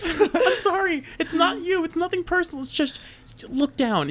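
high, laughing, embarrassed plea; awkward apology. Retold or imitated. Mascot telling the fan her top fell out, flustered. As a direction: politely beg her to look down. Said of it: "I had to tell her to please stop".

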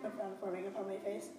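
ordinary delivery, nothing marked

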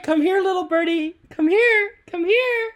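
high, sweet calling to a pet; coaxing pet voice. Pretend. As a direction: lure the birdie in gently. Coaxing the pretend bird closer.

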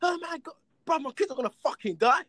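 high, loud panicked shrieking; hammed-up horrified gasping; mock panic. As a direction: play the rich guy freaking out over burning cash. Mimicking panicked rich people.

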